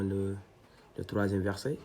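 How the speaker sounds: ordinary talk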